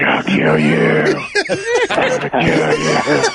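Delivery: slow, shouted, drawn-out threat; mock furious threat. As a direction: yell it like a raging lineman. Retold threat from angry teammate.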